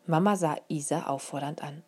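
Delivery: ordinary delivery, nothing marked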